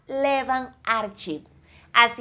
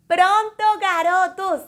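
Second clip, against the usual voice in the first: cheerful call; 8 semitones higher, 5 dB louder than usual. Real, not acted. Teacher wraps up lesson.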